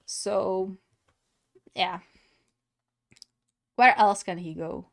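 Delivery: usual speaking voice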